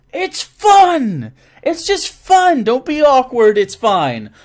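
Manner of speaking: loud, high burst of enthusiasm; excited urging. Real, not acted. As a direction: cheer the shy players on, high and loud. Urging awkward players to have fun.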